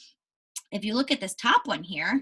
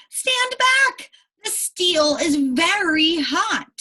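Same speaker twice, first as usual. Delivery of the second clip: high, loud, urgent call of stand back; warning shout; 8 semitones higher, 6 dB louder, much slower than usual. Acted. Teacher voicing a caption warning to the class, loud and high. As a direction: shout a warning like a safety sign.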